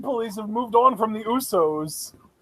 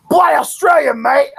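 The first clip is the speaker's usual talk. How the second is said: put-on loud, higher caricature Australian accent shouted out; over-the-top Australian accent; 7 semitones higher, 5 dB louder than usual. Imitating wrestler's Australian nickname catchphrase, hammed-up Australian accent shout. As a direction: bellow it like a cartoon Aussie wrestler. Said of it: "ridiculous over-the-top Australian accent".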